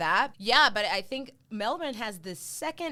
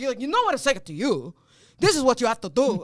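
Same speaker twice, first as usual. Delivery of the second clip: loud, regimented restaurant owner impression; mock-yell orders like the Greek boss; imitated yelling boss; 6 dB louder than usual. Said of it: "It always sounds like he's yelling at you".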